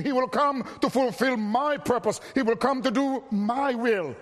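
ordinary talk

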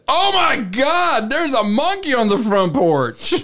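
high, slow mock alarm; shocked quote. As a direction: ham up the victim's shock. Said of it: "I took a second glance and said".

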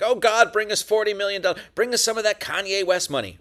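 high, loud, hammed-up begging to God; mock greedy prayer. Joking about praying for money, hammed-up high begging. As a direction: beg God for riches like a televangelist. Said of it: "It's not like it was like".

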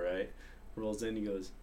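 usual speaking voice

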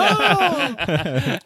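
high, drawn-out delighted "oh"; hammed-up high coo; mock swoon. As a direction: imitate someone swooning at an accent. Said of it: "They're like".

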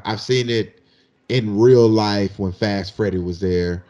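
normal talking voice

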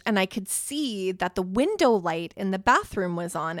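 normal talking voice

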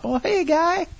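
high-pitched, soft, chummy greeting; sarcastically neighborly; mock friendly welcome. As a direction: greet the intruder in a silly high voice.

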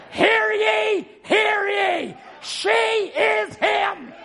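shouted high, slow proclamation; town crier. Retold or imitated. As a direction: cry it out like a herald. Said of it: "God sent a word crier".

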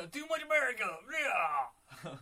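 loud, high, hammed-up bellow; jokey roaring cheer; mock patriotic yell. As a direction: holler it like an over-the-top patriot. Mock patriotic echo of co-host's joke.

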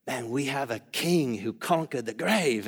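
light Australian accent, imitating a friend; a hint of Australian twang for the quote. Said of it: "if you've heard his Australian accent".

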